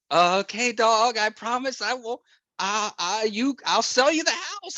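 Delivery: put-on high, stammering childish pleading; pleading baby talk. Narrator promising the dog the house, high nervous childish voice. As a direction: bargain with the dog like a scared kid.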